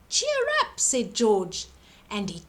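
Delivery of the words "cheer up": high bright encouragement; cheery comfort. A narrator's reading. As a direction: lift them up with a high cheer. Giant comforting the fox.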